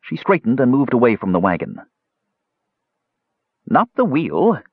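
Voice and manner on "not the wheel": put-on high-pitched, sharp old crone. A narrator's reading. Old woman correcting Tanis about the wheel, sharply. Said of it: "she said sharply".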